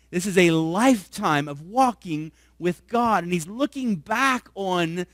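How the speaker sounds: rising pulpit fervor; building sermon. Acted. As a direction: drive it home to the congregation. Preaching at rising intensity.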